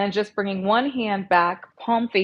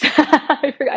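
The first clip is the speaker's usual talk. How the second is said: laughing; 7 semitones higher, 7 dB louder, slower than usual. Real, not acted. Laughs at own loud voice.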